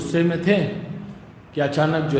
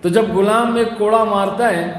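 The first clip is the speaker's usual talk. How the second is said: ordinary talk, nothing marked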